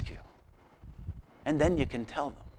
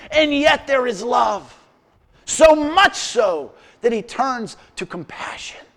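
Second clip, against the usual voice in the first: loud, higher preacher's proclamation; full-force preaching; 9 semitones higher, 8 dB louder than usual. Acted. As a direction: preach it out to the room.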